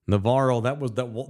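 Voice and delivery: normal talking voice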